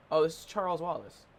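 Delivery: ordinary talk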